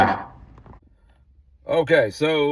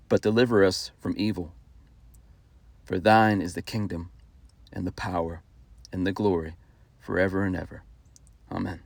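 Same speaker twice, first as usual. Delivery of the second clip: prayer recitation; 4 semitones lower, 5 dB quieter than usual. Acted.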